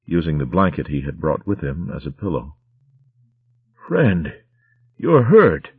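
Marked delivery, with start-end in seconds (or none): 3.9-5.7 higher, louder alarmed cry. Acted. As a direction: cry out to a wounded friend. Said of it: "he cried".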